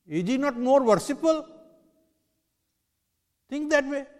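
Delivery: high, loud sermon build; preaching. Real, not acted. Preaching at full force.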